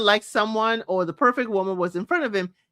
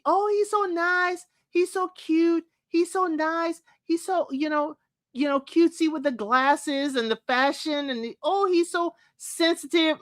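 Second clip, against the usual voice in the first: mock gushing fans; 7 semitones higher than usual. Mocking viewers who adore Steven, high and swooning. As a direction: gush like a starry-eyed fan. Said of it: "Y'all see".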